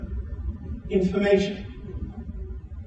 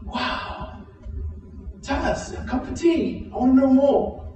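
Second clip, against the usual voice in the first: breathy wow, then high eager pleading; eager amazement; 12 semitones higher, slower than usual. Acted. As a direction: act out fascinated neighbors, voice pitched right up. Acting out curious, delighted neighbors.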